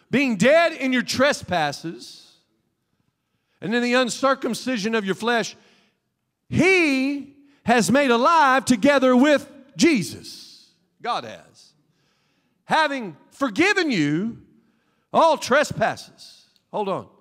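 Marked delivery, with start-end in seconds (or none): 0.1-10.7 slow, weighty reading of the verse; scripture reading. Acted. Pastor reads Colossians to the congregation, slow and weighty. 12.6-16.5 slow, weighty reading of the verse; scripture reading. Acted. Pastor reads Colossians to the congregation, slow and weighty.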